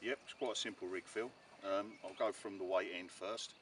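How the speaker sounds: ordinary talk, nothing marked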